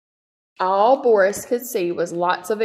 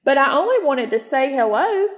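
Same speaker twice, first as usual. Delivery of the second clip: calling out; 5 semitones higher, 5 dB louder than usual. Acted. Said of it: "called Boris".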